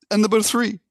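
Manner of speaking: the Pope wailing his refusal in a put-on accent; mock accent Pope. Pope refusing the assistant, high and loud in a mock accent. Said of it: "I'm gonna do an accent too".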